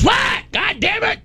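high angry shout back; angry yell. Retold or imitated. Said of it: "Doug goes".

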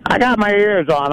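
ordinary talk, nothing marked

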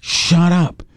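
stretched, weary hush; slow drawn-out shut up. Retold or imitated. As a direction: drag it out, fed up. Telling Gene to shut up.

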